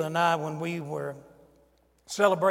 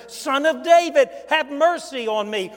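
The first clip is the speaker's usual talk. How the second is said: louder, higher pleading call; blind beggar's cry; 7 semitones higher, 7 dB louder than usual. Retold or imitated. Blind Bart crying louder to Jesus for mercy. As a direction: cry out even harder for mercy. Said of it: "No way was blind Bart".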